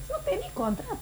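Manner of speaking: very high, tiny soft voice; mock-pleading little squeak; squeaky aside. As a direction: object in a small falsetto. Squeaky aside about resigning.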